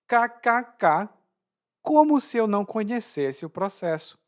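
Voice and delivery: mock written-out laugh, high and emphatic; high-pitched ironic disbelief. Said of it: "como uma maneira de ironizar".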